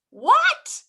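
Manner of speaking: very high, loud squeal of "What?"; wife crying out at the news; shocked cry. A narrator's reading. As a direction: jump way up in alarm.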